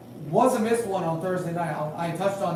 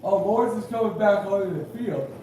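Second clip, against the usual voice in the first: ordinary talk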